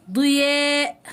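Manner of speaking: held, steady final vowel; drawn-out model word. Shown as a demonstration. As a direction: stretch the word out for pupils. Teacher models example word. (نطق ممدود للكلمة)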